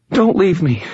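high, pleading whimper; whimpering plea. A narrator's reading. Injured girl begs the narrator to stay, high whimper. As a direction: beg him not to go, a frightened girl. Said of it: "she whimpered".

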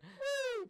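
very high, loud "woo"; siren whoop. Acted. Ocean police pulling ship over, mock siren wail.

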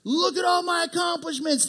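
hammed-up boastful cry; boastful shout. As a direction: crow over his achievements. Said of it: "Pharisees over here like".

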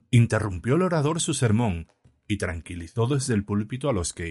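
plain reading voice

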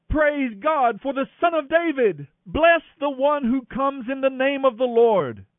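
high joyful shout; crowd shouting. A narrator's reading. Crowd hails Jesus in the procession, shouting high. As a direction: proclaim it high and loud. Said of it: "the crowds all around him were shouting".

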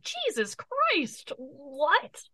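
drawn-out, high, incredulous gasp; slow, shocked Jesus Christ; stunned disbelief. Retold or imitated. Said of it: "and then you're just like".

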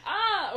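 high, loud laughing gasp; a loud mock gasp; mock shriek. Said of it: "He doesn't go, like".

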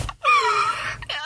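shrieking laugh; high laugh. Real, not acted. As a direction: crack up at the memory. Laughing at the memory.

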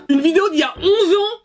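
high-pitched disbelief; stupéfait. Real, not acted. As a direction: blurt out an astonished discovery. Finds an eleven-year-old video. (stupéfaction, voix qui monte dans les aigus)

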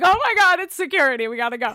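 high, loud sudden panic; panicked alarm. Retold or imitated. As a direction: jump at the noise in fright. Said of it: "you're like".

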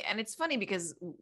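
ordinary talk

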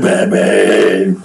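lightly put-on Batman voice; a hint of mock superhero. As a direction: drone the name in mock Batman style. Mocking a Batman film.

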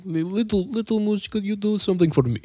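lightly voiced thug, heavy accent; Russian mobster voice. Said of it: "That's not bad".

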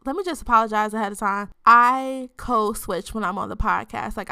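usual speaking voice

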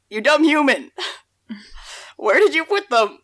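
scolding herself. Retold or imitated. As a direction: tease yourself for being silly. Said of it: "and I was like".

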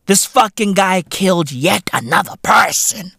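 usual speaking voice

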